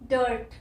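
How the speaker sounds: डर्ट with a half, soft r; डर्ट said with a barely-there r; soft r demo. Shown as a demonstration. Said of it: "soft sound है उसकी". (डर्ट में हल्का आर)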